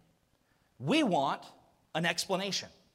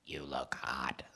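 low, soft, machine-flat whisper; deadpan robotic compliment; whispered app voice; 9 dB quieter, slower than usual. Retold or imitated. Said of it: "rough on my marriage".

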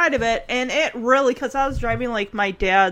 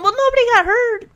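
defensive daughter; 11 semitones higher, 5 dB louder, faster than usual. Retold or imitated. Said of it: "she's like".

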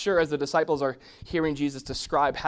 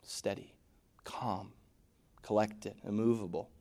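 soft, slow, whispered steadiness; hushed words of stillness; whispered calm; 4 semitones lower, 6 dB quieter than usual. Shown as a demonstration. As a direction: breathe each word out calmly. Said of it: "steady as can be".

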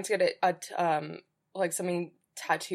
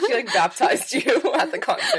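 louder teasing line, laughing through it; laughing tease; 7 semitones higher, 8 dB louder than usual. Real, not acted. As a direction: giggle the joke out at her friend. Teasing joke about the mix-up.

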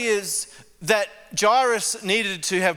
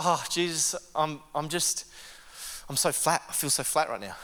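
flat prayer; 5 dB quieter than usual. Retold or imitated. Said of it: "I'm just like".

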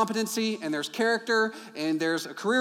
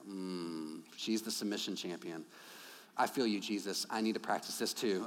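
mock pious murmur; 12 semitones lower, 12 dB quieter than usual. Husband's mock inner prayer.

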